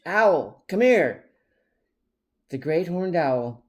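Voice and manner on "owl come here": loud, higher commanding call; loud firm summons; direct call. A narrator's reading. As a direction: summon the owl firmly. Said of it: "tried a more direct approach".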